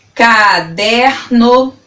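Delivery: CADERNO slowly sounded out as ca-der-no; syllable by syllable. Shown as a demonstration. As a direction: stretch each syllable of CADERNO. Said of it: "falando elas pausadamente pra você".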